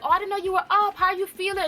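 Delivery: bright, high fake-innocent voice; high sweet Miranda voice.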